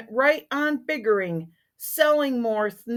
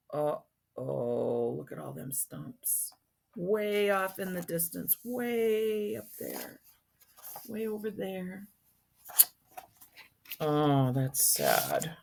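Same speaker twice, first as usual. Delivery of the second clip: hushed sad aside; 3 semitones lower, 10 dB quieter than usual. Real, not acted.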